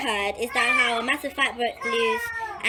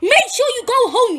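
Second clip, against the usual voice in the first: higher, louder mock caring voice; quoted guy; 8 semitones higher, 8 dB louder than usual. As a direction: imitate him fussing over you. Retold guy warning her home.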